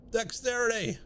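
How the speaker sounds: excited cheer. Real, not acted. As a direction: celebrate the stat boost. Gleefully counting stat gains.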